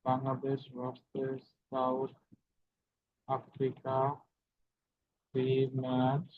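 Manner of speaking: slow, soft word-by-word dictation. Real, not acted. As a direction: spell it out slowly while typing. Dictates title while typing it.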